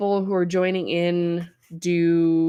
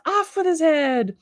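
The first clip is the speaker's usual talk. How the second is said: higher, mock royal decree; Queen of Hearts; 10 semitones higher than usual. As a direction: play a tyrant queen giving a beheading order. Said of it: "channeling my inner Queen of Hearts".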